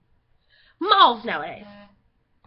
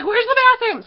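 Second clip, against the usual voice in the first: higher and louder, panicked question to a guard; frantic call; 8 semitones higher, 8 dB louder than usual. Retold or imitated. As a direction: blurt it out in a rush, lost in a huge mall. Frantic kid asks security guard.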